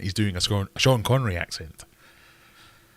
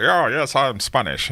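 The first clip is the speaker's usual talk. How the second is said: raised, exaggerated mock movie-star line; mocking Sean Connery; 7 semitones higher, 5 dB louder, slower than usual. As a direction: lightly send up the actor's claim to be Spanish. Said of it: "He's like".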